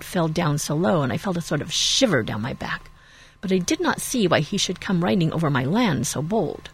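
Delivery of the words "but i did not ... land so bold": usual speaking voice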